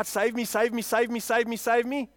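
half-hearted pretend wail for rescue; mock crying out. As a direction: imitate someone begging on a loop. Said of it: "you're gonna cry".

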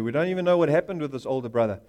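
ordinary talk, nothing marked

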